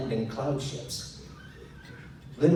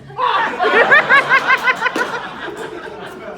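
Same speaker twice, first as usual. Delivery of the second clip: high burst of laughter; loud laugh; 13 dB louder than usual. Real, not acted. Narrator laughing at the harmless remark. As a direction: crack up loudly.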